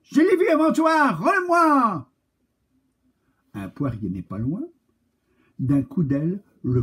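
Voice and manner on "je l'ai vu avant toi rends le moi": high, fast, loud cat yell; the cat chasing the crow, demanding; angry cat shouting. Acted. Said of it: "crie alors un chat".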